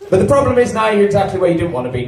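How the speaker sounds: ordinary talk, nothing marked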